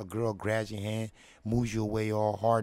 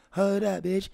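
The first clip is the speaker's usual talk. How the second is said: higher, louder "Hold up, bitch"; sassy yell; 9 semitones higher, 5 dB louder than usual. Retold or imitated. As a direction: call her out, loud. Girl voiced competing.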